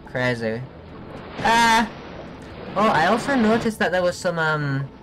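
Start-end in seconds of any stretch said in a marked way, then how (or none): 1.4-1.9 short, high, loud yelp; sudden cry of alarm; startled yelp. Real, not acted. Player startled mid-game, high loud yelp.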